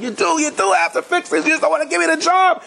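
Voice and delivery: high-pitched mock screaming tirade; retold screaming rant. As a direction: go ballistic as the deranged fixer, pitch way up. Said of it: "screaming, yelling".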